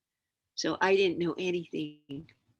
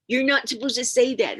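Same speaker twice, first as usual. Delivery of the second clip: put-on higher, louder child's objection; mock scolding kids; 7 semitones higher, 7 dB louder than usual. Little kids telling off their teacher, mock scolding. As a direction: lightly imitate indignant pupils.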